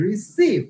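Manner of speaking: Receive, jumping up on সিভ and falling; second-syllable stress. Shown as a demonstration. Teacher confirming the rule, second syllable of receive stressed. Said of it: "second সিলেবলে প্রেশারটা যাবে".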